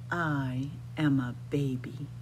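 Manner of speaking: usual speaking voice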